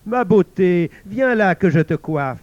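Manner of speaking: loud, high motherly call. Acted. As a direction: call your daughter in from the garden. La mère appelle sa fille au jardin. (mère qui appelle sa fille, voix forte)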